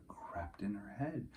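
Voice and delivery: hushed whisper of a secret idea. A narrator's reading. Narrator whispers Rosie's secret idea at bedtime. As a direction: breathe it like a secret.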